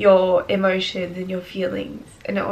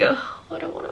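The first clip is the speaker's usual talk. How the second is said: tearful break; 8 semitones higher, 6 dB quieter than usual. Real, not acted. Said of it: "I'm crying".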